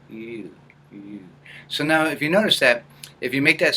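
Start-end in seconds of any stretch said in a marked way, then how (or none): 0.1-1.2 soft ew placed in the upper nose; tiny disgusted ew from the upper nasal; small nasal ew. Shown as a demonstration. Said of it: "So just a small little sound".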